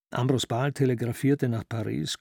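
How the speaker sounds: ordinary delivery, nothing marked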